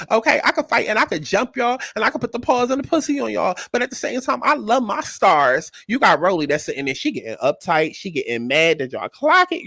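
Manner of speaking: ordinary talk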